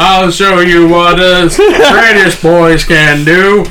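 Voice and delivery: slow, loud parody of American speech; hammed-up American boast, dragged out; mock American accent. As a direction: exaggerate an American drawl, loud and stretched. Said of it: "You said that in American accent".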